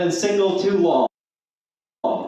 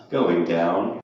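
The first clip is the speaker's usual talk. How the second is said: much lower, sultry elevator voice; sensual voice; 13 semitones lower than usual. Acted. Comic imitates an elevator announcing its floor, sensual voice. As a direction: purr it low like a seductive elevator. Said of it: "it said in a sensual voice".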